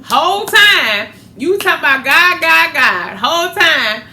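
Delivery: loud, high preaching scold; loud, high, heated rebuke of a fake believer; fired-up scolding. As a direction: rail at the hypocrite from the pulpit. Scolding the hypocrite.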